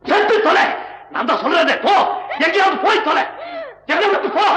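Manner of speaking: enraged husband shouting her away; furious shout. Acted. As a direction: scream at her to get lost. Husband's furious quarrel outburst.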